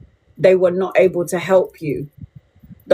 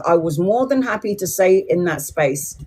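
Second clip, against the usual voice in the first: usual speaking voice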